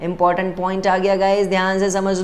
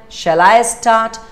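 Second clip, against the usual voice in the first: slow model sentence; 4 semitones higher, 5 dB louder, much slower than usual. Shown as a demonstration. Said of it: "सबको समझ में आया?".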